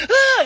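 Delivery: sharp, very high, loud retold scream. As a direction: relive the fright in one yelp. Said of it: "And I screamed".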